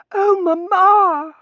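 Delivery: high, loud, drawn-out wail; distraught daughter. Acted. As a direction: wail over your fainted mother. Daughter over fainted mother.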